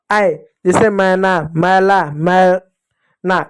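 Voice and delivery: ऐ and मैना, मैला stretched very slowly; drawn-out ai. Shown as a demonstration. Teacher showing the ai sound, very slow मैना. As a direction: spell the ai out syllable by syllable. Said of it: "ऐ का उच्चारण".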